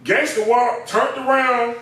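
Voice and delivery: ordinary talk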